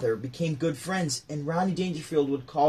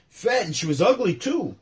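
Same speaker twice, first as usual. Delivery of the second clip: high, loud punchlines with a big whew; hammed-up comic exasperation; Rodney Dangerfield bit; 4 semitones higher, 5 dB louder than usual. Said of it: "And then he goes".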